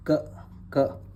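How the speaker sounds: क, क whispered twice as a bare k; isolated letter sound. Shown as a demonstration. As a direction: clip out क, क voiceless and crisp. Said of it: "इसकी sound है English में के की तरह".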